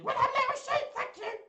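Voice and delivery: very high, loud excitable puppet; loud squeaky toy sheep voice; Little Sheep. Pretend. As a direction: squeal it as an over-eager tiny sheep. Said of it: "in Little Sheep's voice".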